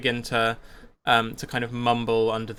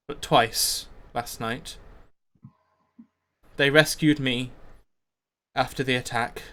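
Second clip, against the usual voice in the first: solemn town leader. Acted. Leader speaking to his community of Glaric's crime, measured and solemn. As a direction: address the crowd with long pauses. Game master voices a leader's speech.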